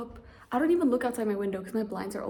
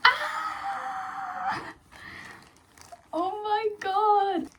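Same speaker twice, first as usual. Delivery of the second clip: very high, drawn-out squeal; high, stretched-out delight; overjoyed squeal; much slower than usual. Real, not acted. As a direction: squeal at finally seeing it. Sees the awaited item.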